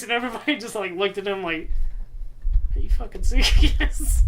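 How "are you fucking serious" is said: rising, incredulous laugh-tinged question. Retold or imitated. Everybody looking at him in disbelief, incredulous. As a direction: ask it in disbelief, pitched up.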